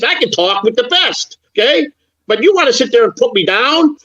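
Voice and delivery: heated comeback. Pretend. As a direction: fire back at the insult, voice climbing high. Role-play argument with Kevin.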